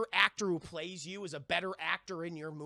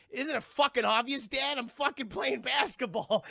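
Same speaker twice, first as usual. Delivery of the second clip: put-on louder, higher snotty teenage retort; sarcastic kid; 5 semitones higher, 6 dB louder than usual. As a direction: sneer it at your dad like a bratty kid. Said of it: "if I was a kid".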